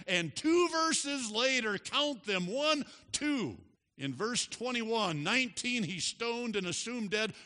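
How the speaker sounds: high, excited pulpit storytelling; forceful preaching. Real, not acted.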